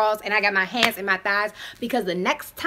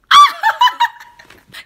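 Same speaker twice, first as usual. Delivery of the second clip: a high, loud burst of shocked laughter; shrieking laugh; 14 dB louder than usual. Real, not acted. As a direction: cackle in disbelief.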